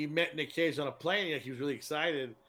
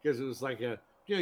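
normal talking voice

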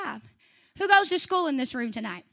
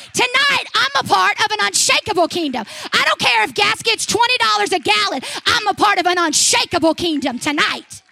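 rising, louder preacher's cry, hammering the kingdom line; a preacher pounding out his point; full-force preaching; 4 semitones higher, 8 dB louder than usual. Acted. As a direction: preach it out to the room, building. Preaching to the congregation.

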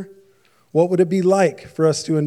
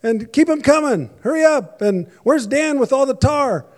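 much higher, loud foreman's shout across the site; calling out; 9 semitones higher, 5 dB louder than usual. Retold or imitated. Foreman Glenn shouting to Roger at the job site, loud and bossy. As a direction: yell orders over the noise. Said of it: "he calls out".

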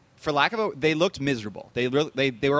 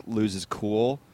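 usual speaking voice

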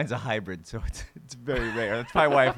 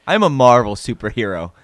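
loud, hammed-up wisecracking hero; mock Marvel superhero; 9 dB louder than usual. Co-host parodying a Marvel superhero, loud wisecracking voice. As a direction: belt it out as a cocky comic-book hero. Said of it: "That's my superhero voice".

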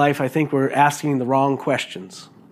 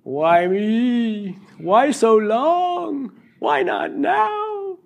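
rising higher, slow whining questions; plaintive why; 8 semitones higher, much slower than usual. Retold or imitated. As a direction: plead with God, stretching each why. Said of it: "you asked God why".

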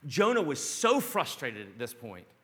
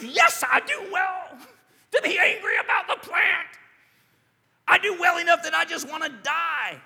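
furious shout, much higher and louder; defiant shout; 14 semitones higher, 11 dB louder than usual. Acted. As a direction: hurl it back at God in rage. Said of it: "Jonah comes back at God".

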